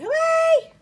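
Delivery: high squeaky hooray; cheery cartoon cheer. Voicing the party rat.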